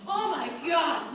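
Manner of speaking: normal talking voice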